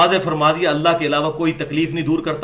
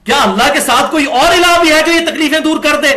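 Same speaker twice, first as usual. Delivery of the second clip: raised, louder pitched question; rhetorical challenge; 9 semitones higher, 5 dB louder than usual. Real, not acted. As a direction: challenge the crowd to answer. (ऊँची आवाज़ में ललकारता सवाल)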